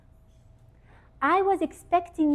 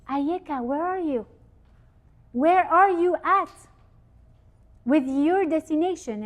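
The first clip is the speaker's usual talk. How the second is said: calling out; 5 semitones higher, 3 dB louder than usual. Acted. God calls each person.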